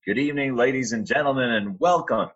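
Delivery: a grand arena welcome, slowed; arena announcer. Retold or imitated. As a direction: boom it out like the arena PA. PA welcome at the Garden.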